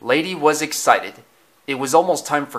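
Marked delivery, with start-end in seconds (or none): none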